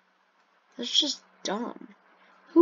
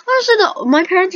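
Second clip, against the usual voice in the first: sudden outburst; 10 semitones higher, 11 dB louder than usual. Real, not acted. Sudden high loud outburst.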